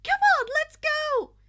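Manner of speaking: very high, loud whoop; cheering. Acted. Mina cheering at being picked, very high whoop. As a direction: shriek with glee.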